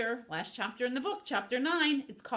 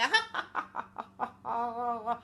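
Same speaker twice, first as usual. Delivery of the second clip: long staccato cackle; evil villain laugh; 2 semitones higher than usual. Acted.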